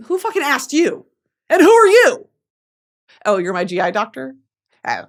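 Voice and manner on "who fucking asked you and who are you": high, loud, indignant snap; who do you think you are, loudly; indignant outburst. Acted. Comedian snapping at her doctor, high and indignant.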